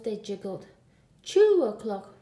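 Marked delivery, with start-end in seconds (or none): none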